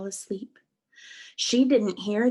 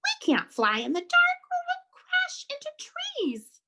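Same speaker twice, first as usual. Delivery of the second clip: very high, anxious squeaky voice; squeak. Acted. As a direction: squeak in alarm, climbing high. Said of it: "Stella Luna squeaked".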